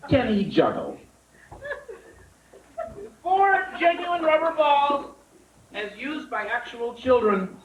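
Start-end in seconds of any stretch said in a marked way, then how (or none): 3.2-7.6 slow, high showman build-up for the juggling; hammed-up ringmaster voice, drawn out; mock grand announcement. Comic to crowd, grandly presenting rubber balls, hammed-up announcer.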